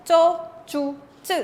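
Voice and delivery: "Cho, chu, chu" punched out with little puff; little air. Shown as a demonstration. Teacher demonstrating tense consonants, little air on "Cho, chu, chu". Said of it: "No quitamos tanto aire al pronunciar".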